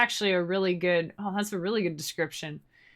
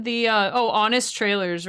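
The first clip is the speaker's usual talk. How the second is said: ordinary talk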